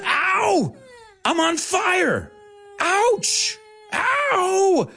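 high hammed-up yelps of pain; crying out in pain. Bill Murray, hit with a golf club, crying out in mock pain. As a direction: yelp like you're on fire. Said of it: "Bill Murray cries in pain".

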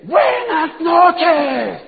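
hammed-up high shout, hugely drawn out; booming greeting. Doctor addressing a tiny orientation audience, absurdly booming greeting. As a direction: stretch every vowel in a mock grand yell. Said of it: "y dice".

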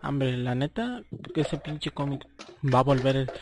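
ordinary talk

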